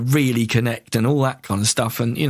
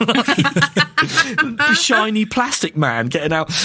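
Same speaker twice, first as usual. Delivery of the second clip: laughing; 8 semitones higher, slower than usual. Real, not acted. Laughing self-mockery.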